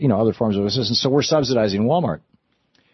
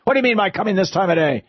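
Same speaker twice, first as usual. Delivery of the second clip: high, fast, stern miser voice; stern Scrooge; 8 semitones higher, faster than usual. Acted. Scrooge scolding Bob for arriving late, fast and stern. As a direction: scold the clerk in mock fury. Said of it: "growled Scrooge".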